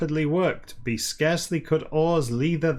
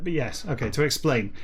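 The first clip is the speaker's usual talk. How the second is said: plain reading voice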